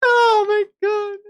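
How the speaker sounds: very high, slow, breathless laugh-talk; laughing oh my God. Real, not acted. Still cracking up after the joke, laughing. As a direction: squeal it through laughter. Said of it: "still laughing".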